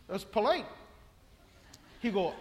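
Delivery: normal talking voice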